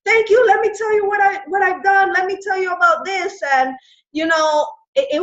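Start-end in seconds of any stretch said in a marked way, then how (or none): 0.1-3.4 higher-pitched, eager thanks; acting out an excited reply; excited retelling. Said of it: "I was just so excited".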